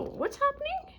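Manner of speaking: high, puzzled question; confused. Pretend. Viewer skipping ahead, high baffled question. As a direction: sound lost and baffled.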